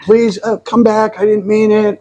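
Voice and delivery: high-pitched mock begging; hammed-up high plea; retold pleading. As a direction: imitate calling after her, pleading up high. Retold running after her, pleading.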